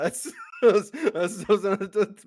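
high, loud cracking laugh-talk; laughing protest. Real, not acted. Denying the Epstein Island joke to his friend, loud laughing protest. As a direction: sputter it out through laughter.

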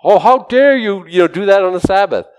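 high-pitched mock outrage; indignant objector. Mocking religious people objecting to a Sabbath healing, indignant. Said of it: "what are we like?".

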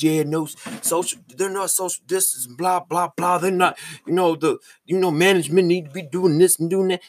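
whiny mock of the complainers. Mimicking complaining coworkers online.

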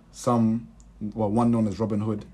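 ordinary talk

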